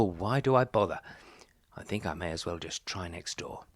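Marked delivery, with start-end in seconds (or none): none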